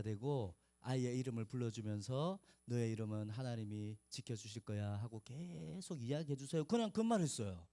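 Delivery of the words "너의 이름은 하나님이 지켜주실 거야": tender blessing. Retold or imitated. Blessing said into the ear.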